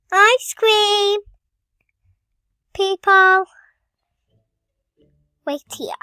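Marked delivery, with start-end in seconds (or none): 0.1-3.4 high, loud acted call. Story character calls out about ice cream, loud call. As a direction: call out loudly, pitched up.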